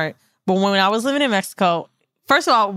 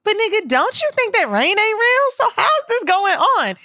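very high mock questioning; mock challenge; 12 semitones higher than usual. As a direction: poke holes in his logic, high. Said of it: "I be like".

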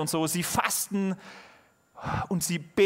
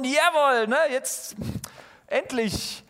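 cheering; 7 semitones higher, slower than usual. Pretend. Excited preacher cheering response.

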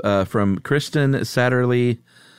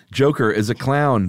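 ordinary talk

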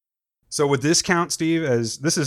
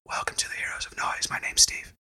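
whispered show intro; host the show in a whisper; ASMR whisper; 7 dB quieter than usual. Pretend. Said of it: "We'll do a whole show like that".

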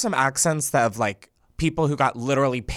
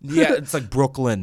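put-on Brooklyn accent; name the borough like a Brooklynite. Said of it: "a Brooklyn accent".

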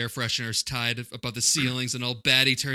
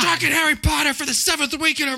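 excited announcer; 13 semitones higher than usual. Acted. Mock announcer exclamation.

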